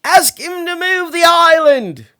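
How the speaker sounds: high, loud call upward; shouted. Acted. Seville shouting up to the giant, loud call. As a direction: shout it up to a giant far above. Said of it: "Seville shouted up to Much".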